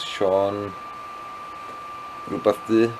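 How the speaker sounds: ordinary talk, nothing marked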